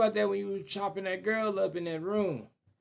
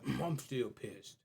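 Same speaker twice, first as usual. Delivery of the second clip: low, muted grumble of lingering anger; still pissed; 6 semitones lower, 11 dB quieter, slower than usual. Acted. Killer still stewing after all that effort, low sullen grumble. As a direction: grumble it softly and slowly, low in the voice. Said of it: "enough time to be like".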